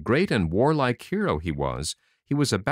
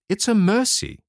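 relieved tailor; 11 semitones higher, 5 dB louder than usual. A narrator's reading. Tailor relieved after fight.

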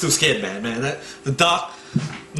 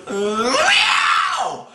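high, drawn-out yowling meow; cat meow noise; 11 semitones higher than usual. Retold or imitated. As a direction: imitate a cat's sudden screechy yowl. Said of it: "it made that cat meow noise".

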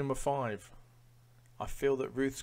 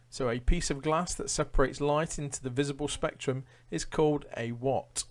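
louder, higher quizmaster read-out; quiz question read; 5 semitones higher, 8 dB louder than usual. Acted. Quizmaster reading questions to Facebook Live viewers, announcer style.

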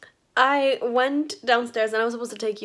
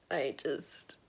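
unvoiced whisper; 7 semitones lower, 10 dB quieter than usual. Real, not acted. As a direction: trail off in a whisper. Whisper trailing off.

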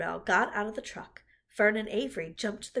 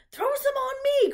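very high, loud yell; high-pitched kid clamoring; boyish cry; 10 dB louder than usual. A narrator's reading. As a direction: shout like an eager boy. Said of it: "cried Avery".

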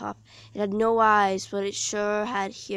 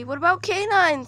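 worried; 9 semitones higher, 9 dB louder than usual. A narrator's reading. Worried question while fleeing.

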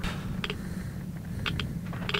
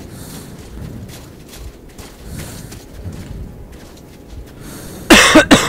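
long coughing fit ending loud; sick coughing; 3 semitones lower, 5 dB louder than usual. Real, not acted. Sick gamer interrupted while playing, real coughing fit. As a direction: cough hard, as someone still ill. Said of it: "still a bit sick".

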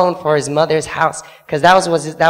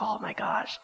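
shocked whisper; 5 semitones higher, 16 dB quieter, slower than usual. Retold or imitated. Shocked at seeing crying man.

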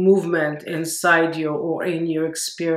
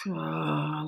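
audible exhale "ah"; 5 dB quieter than usual. Shown as a demonstration. Teacher showing how to release inner noise, sighed exhale. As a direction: breathe out through the mouth with a gentle voiced sigh. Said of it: "from your mouth and with sound like".

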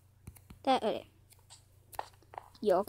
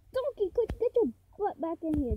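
higher-pitched call to a pet; calling, exasperated; 6 semitones higher than usual. Real, not acted. Player ordering the runaway donkey back inside, exasperated call. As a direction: call the animal in, voice raised in pitch.

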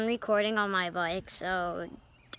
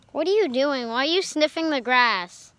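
scolding dog; 4 semitones higher, 8 dB louder than usual. Real, not acted.

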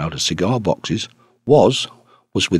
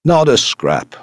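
excited, higher-pitched exclamation; carried away; 6 semitones higher, 3 dB louder, slower than usual. A narrator's reading. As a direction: cry out, quite carried away. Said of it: "cried Blunt, quite carried away".

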